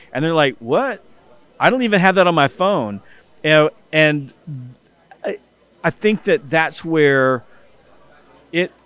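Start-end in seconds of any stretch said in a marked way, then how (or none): 0.6-3.0 surprised disbelief. Retold or imitated. Friend shocked the speaker uses ChatGPT, higher incredulous voice. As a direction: pitch jumps up on "What?", then a louder, higher incredulous line.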